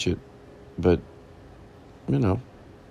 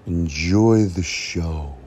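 drawn-out announcer enjoy the show; slow it way down, grandly; slow dramatic; much slower than usual. Pretend.

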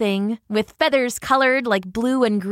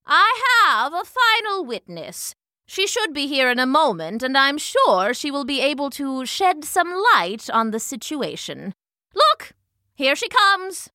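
higher, emphatic elder's address; announcing old hornet; 6 semitones higher than usual. A narrator's reading. Kemba announcing final witness to the crowd, emphatic. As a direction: proclaim it, ending on a high excited call.

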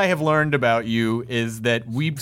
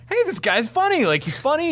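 excited inner voice; 13 semitones higher than usual. Retold or imitated. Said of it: "I was like".

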